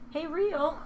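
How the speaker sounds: high, sing-song call to a pet; calling the cat. Real, not acted. Owner calls her cat to the stream, sing-song. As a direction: coax the cat to come.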